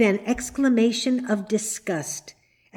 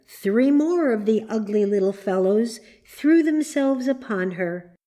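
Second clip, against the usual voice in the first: ordinary delivery, nothing marked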